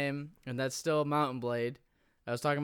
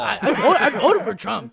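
flustered joke; 9 semitones higher, 12 dB louder than usual. Pretend. Flustered joking protest.

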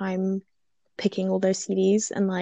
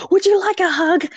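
imitating a sudden high, loud yell; mock yell; 11 semitones higher, 7 dB louder than usual. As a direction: yell the kind offer like a threat. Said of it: "just yells at her".

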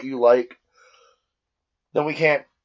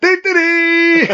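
much higher, louder, slow drawn-out deet; Carlos Mencia impression; 11 dB louder, much slower than usual. Cohost impersonates a comedian, shouting deet high and slow. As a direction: belt it like a comic on stage. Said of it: "Carlos Mencia already did that".

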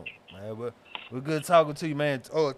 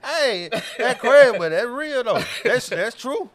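laughing; 6 semitones higher, 7 dB louder than usual. Real, not acted. Laughing reaction to the joke.

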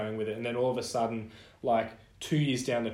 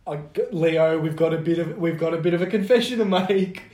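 mock confession; 4 semitones higher, 4 dB louder than usual. Imagined confession speech to Leo.

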